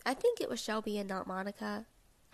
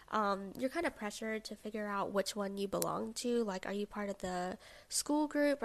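ordinary delivery

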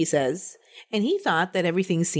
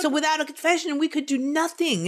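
mock helpless, higher pitch; pitched-up mocking quote; imitating police excuse; 8 semitones higher than usual. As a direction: plead helplessness as a mocked detective. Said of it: "They're like".

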